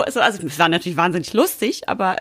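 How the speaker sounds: normal talking voice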